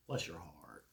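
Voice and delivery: low, hushed, sugary "Bless your heart"; quiet deadpan dig; sarcastic blessing. Retold or imitated. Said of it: "our way of being sarcastic".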